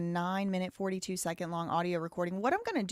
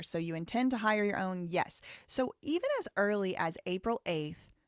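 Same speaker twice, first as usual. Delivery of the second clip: normal talking voice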